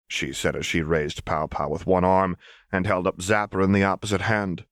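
plain reading voice

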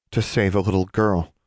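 plain reading voice

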